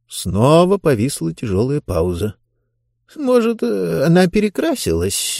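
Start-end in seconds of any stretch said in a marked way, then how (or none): 3.2-5.4 unsure, grasping at straws. A narrator's reading. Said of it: "неуверенно сказал Бон".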